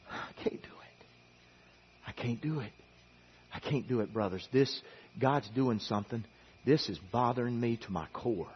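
whispered, broken refusal from Nehemiah; barely voiced, grieving whisper; hushed anguished whisper. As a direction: breathe it out like a man overwhelmed.

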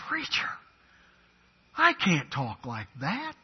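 mock protest. Voices protesting congregant.

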